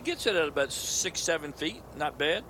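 ordinary talk

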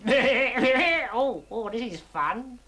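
enjoy it like a ride; lightly voiced delighted frog. Ernie enjoys water pouring on him.

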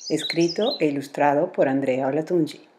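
put-on clear, bright title read to children; storybook title announcement; announcing the title. A narrator's reading. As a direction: present the book with a lift. Reads title and credits.

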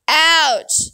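high, sudden yelp of pain; hurt man squealing. A narrator's reading. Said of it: "his friend squealed".

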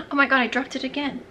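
gasping oh my God; startled gasp. Real, not acted. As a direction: caught off guard, dismayed. Just dropped the bottle.

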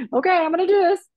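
gasp, then high, loud resolve; psyching herself up. Retold or imitated. Retelling finally getting the nerve, hyped-up resolve. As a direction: re-enact working up the nerve.

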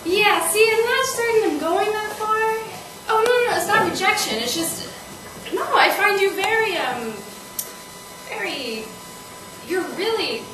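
flustered, high-pitched excuse; awkward backpedaling. Acted. Woman dodging her date's apartment hint, flustered backpedaling. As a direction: protest a little too quickly and high, voice lifted.

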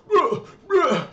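high, loud straining bruh bruh; workout grunts. Retold or imitated. Imitating himself working out, high loud grunts. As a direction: grunt it like lifting a heavy weight. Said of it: "when I go".

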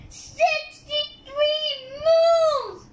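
high, loud shouted answer; thrilled yell at the answer; excited shout. Real, not acted. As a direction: blast out the big reveal. Kid reveals the quiz answer.

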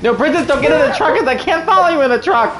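high, loud panicked yelling; shouting. Real, not acted. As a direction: yell after her in alarm. Shouting after fleeing princess.

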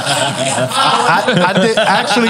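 laughing through the words; chuckling, high and amused. Real, not acted. Laughing at the cousin joke.

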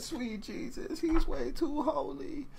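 very high, thin-voiced mock squeal; awed squeal. Reactor quoting the rapper's lyric, squealing in awe. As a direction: repeat the line in high-pitched astonishment. Repeats amazing lyric in disbelief.

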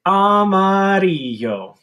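high, loud and slow armadillo voice. Shown as a demonstration. Teacher voicing a hand armadillo. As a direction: let the hand armadillo squeak it. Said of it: "armadillo and say".

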